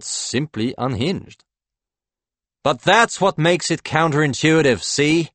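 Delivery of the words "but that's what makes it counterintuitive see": higher, louder, insistent argument; excited pitch. A narrator's reading. Howie selling the new venture to Claude, excited. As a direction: press the point with excitement.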